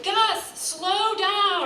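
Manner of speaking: high calling out to her son; yelling. Retold or imitated. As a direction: call out high so he hears. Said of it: "I was yelling to him".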